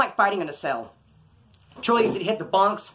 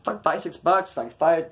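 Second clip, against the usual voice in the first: ordinary delivery, nothing marked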